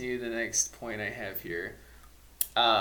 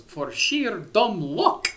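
slow, higher, louder stern teacher voice with a crisp K on luck; hammed-up McGonagall impression; 10 semitones higher, 10 dB louder, much slower than usual. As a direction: mimic McGonagall's dry scolding. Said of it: "You would do a great McGonagall".

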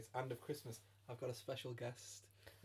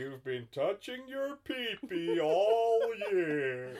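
slow, drawn-out scolding Santa; hammed-up American Father Christmas, swooping in pitch; 3 semitones higher, 9 dB louder, much slower than usual. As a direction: tell off a child as Santa. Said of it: "keep up this accent".

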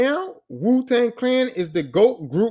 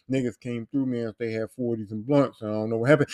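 mock low mumbled excuse; 11 semitones lower, 6 dB quieter than usual. Friend voiced low and soft.

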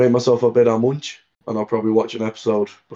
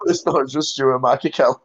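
laughing; 5 semitones higher, slower than usual. Real, not acted. Finishing the joke while laughing.

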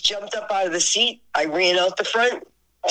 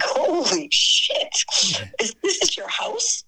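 drawn-out, high amazed exclamation; mock-stunned, pitch leaping up; retold astonishment; 5 semitones higher than usual. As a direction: gape in disbelief, then question it. Astonished at the star's house.

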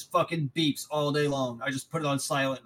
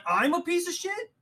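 much higher, disbelieving question; incredulous. Retold or imitated. Telling the coworker he can't believe it, incredulous. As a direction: echo the insult in disbelief.

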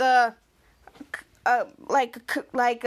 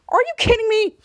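incredulous outburst; 8 semitones higher, 6 dB louder than usual. Retold or imitated. Ray reacts to Henry's teacher.

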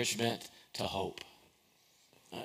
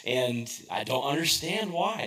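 usual speaking voice

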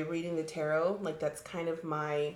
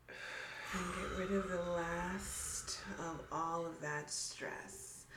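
grounding meditation; 7 dB quieter than usual. Shown as a demonstration. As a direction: gently guide the breathing. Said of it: "why don't we do a little, like, grounding meditation".

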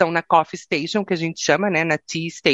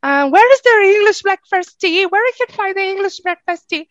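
much higher prim passenger voice; British passengers; 12 semitones higher, 3 dB louder than usual. Retold or imitated. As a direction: imitate a demanding British lady. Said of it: "ficavam toda hora perguntando". (imitando passageira britânica, bem aguda)

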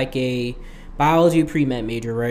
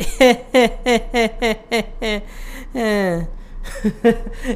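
laughing; 8 semitones higher than usual. Real, not acted. Laughs at own joke.

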